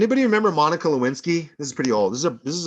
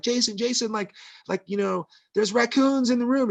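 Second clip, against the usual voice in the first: high, urgent mock yell at the husband; wife waking her husband over raccoons, pitched-up mock yell; retold panicked yelling; 10 semitones higher than usual. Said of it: "starts yelling at the husband".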